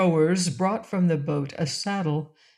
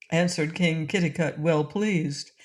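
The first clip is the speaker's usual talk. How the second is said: usual speaking voice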